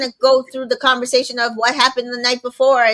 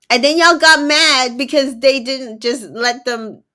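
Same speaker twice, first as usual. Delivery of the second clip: ordinary talk, nothing marked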